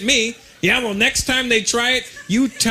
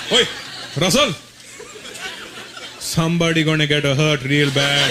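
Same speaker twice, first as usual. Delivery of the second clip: put-on lower, Indian-accented fatherly menace; low, laughing threat from dad; dad's threat; 4 semitones lower than usual. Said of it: "I hated that threat".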